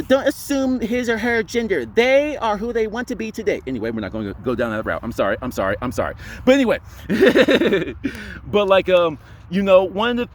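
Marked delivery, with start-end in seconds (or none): 0.0-3.6 high-pitched mock-indignant correction; mock scolding. Vlogger parodies someone correcting him on gender, mock scolding. 6.9-8.4 high giggle at own joke; real laugh. Real, not acted. Vlogger cracks up at his own gender joke, giggling.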